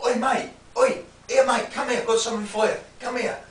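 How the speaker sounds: cheeky mock-friendly street lad; aggressive geezer. Shown as a demonstration. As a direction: beckon him in like a dodgy bloke on the street. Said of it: "Some geezer might be like this".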